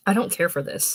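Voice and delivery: ordinary delivery, nothing marked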